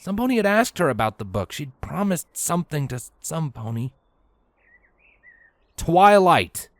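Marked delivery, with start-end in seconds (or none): 5.8-6.7 sudden realization. Acted.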